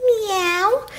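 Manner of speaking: high imitated meow; cat meow. Cat being seated in the wagon, meowing. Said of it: "The cat can stay in this wagon".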